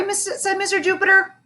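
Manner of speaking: ordinary delivery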